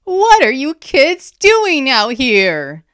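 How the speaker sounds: slow, loud, high-pitched scolding; stern shout. A narrator's reading. Aster confronting kids in a battle, stern shout. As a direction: demand it sternly over the chaos. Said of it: "he asked in a stern voice".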